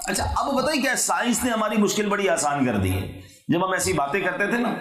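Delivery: ordinary talk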